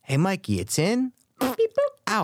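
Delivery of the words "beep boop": high-pitched electronic beep-boop; mock robot beeps. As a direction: imitate a little machine beeping. Imitating machine noises in a bit.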